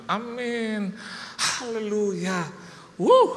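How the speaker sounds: high, loud, drawn-out hallelujah; jubilant praise. Retold or imitated. Believer celebrating the property sale, high jubilant cheer. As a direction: cheer it out, ending in a high whoop. Celebrating retold answered prayer.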